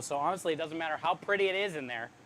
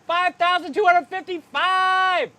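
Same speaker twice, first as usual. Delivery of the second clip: excited announcement; 10 semitones higher, 8 dB louder, slower than usual. Real, not acted. Announcing stream donation total.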